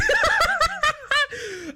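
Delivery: shrieking high cackle; real helpless laughter. Real, not acted. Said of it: "I'm laughing".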